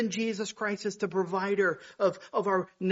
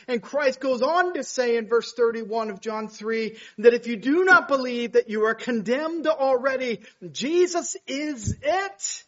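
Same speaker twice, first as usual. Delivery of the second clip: fervent preaching; 6 semitones higher, 8 dB louder than usual. Acted. Holds full preaching force.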